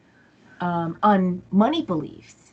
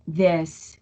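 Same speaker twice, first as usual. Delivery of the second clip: ordinary delivery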